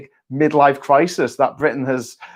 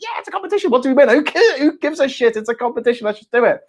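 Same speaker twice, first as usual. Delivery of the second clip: pitched way up, giddy and laughing at first; eager filmmakers; 9 semitones higher, faster than usual. Acted. Filmmakers voiced as thrilled to join a contest, much higher and excited. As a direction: bounce it like a fan who just wants to play.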